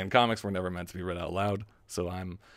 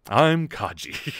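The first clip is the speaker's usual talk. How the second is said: Kaji; 5 semitones higher, 3 dB louder, much slower than usual. Acted. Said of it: "Kaji's voice was really strange".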